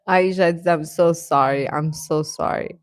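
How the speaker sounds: normal talking voice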